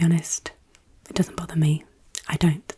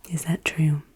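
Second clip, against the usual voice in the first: whispered question; 4 dB louder than usual. Acted. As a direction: ask it in a hushed whisper. Whispered quiz question repeated.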